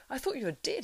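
high, amazed cry; astonished ranger to the hobbit he thought dead; cried out. A narrator's reading. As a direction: blurt it out in disbelief. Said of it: "he cried".

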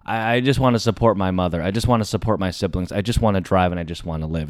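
low, subdued nervous politeness; acted-out timid mumbled brush-off; curt, meek refusal. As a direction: decline softly, just trying to survive. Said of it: "my answers are very, very curt".

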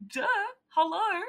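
high, teasing obvious tone; mock duh. Jackie flashing her fangs at Deacon, high and teasing. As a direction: play it like it's totally obvious.

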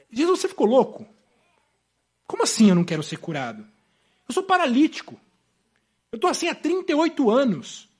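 indignant, taken-aback protest; incredulous paralytic. Acted. Paralytic answers Jesus' question, incredulous and protesting. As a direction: push back as if the question were crazy. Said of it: "é como se ele, se ele, assim".

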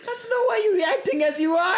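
high, squealing protest through laughter; laughing accusation. Real, not acted. Calling out co-host's reaction, laughing hard. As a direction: call them out while cracking up.

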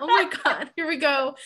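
fighting back tears, pitch up; starting to cry. Real, not acted. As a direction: voice rising as tears come. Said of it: "I just can't talk and cry at the same time".

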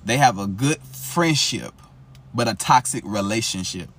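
slow, loud, pointed contrast on "good friendship" and "toxic relationship"; contrast stressed. Shown as a demonstration. Tarot reader driving her point home to viewers, slow and emphatic. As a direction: lean hard on "toxic", said slowly and loudly. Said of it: "Catch what I just said".

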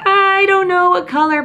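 high, loud dithering voice; mock indecisive. Herself stuck choosing nail colour, high dithering. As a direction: act out the salon indecision. Her own indecision at salon.

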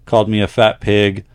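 letter-reading voice; reading a letter aloud. Acted. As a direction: read the listener's story out to the audience. Reads a listener's letter aloud.

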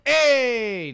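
loud hey shouted for contrast. Shown as a demonstration. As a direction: bark it out like calling across a room. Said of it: "You're not going".

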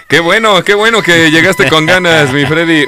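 loud, upbeat welcome; excited. Real, not acted. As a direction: cheer him in with energy. Host cheers Freddy's arrival.

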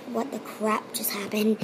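soft unvoiced whisper. The father whispering in shock at what he saw. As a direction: breathe it out with no voice. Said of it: "her father's like".

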